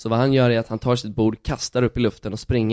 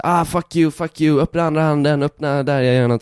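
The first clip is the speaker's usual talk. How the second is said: loud, higher taunting heckler voice; mocking heckler; 4 semitones higher, 4 dB louder than usual. Retelling the gang leader heckling at the street show, loud taunt. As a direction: taunt him like a street thug. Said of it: "jiddra med mig och säga så".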